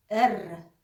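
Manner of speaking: एर with a throaty gurgled r; growled German R. Shown as a demonstration. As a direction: roll एर in the throat. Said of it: "थोड़ा सा घुर्रा के बोलते हैं". (घुर्रा के एर)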